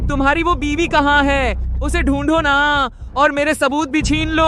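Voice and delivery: high-pitched goading jeer; mocking taunt. Man taunting rival about wife and evidence, mocking.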